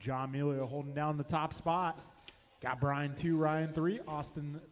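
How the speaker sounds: brisk live race calling, lap times rattled off; race play-by-play. Acted. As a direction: call the race live for the crowd.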